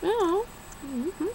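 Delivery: high, sing-song fake cheer; mock chirpy agreement. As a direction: chirp it like an eager kid. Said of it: "so I was like".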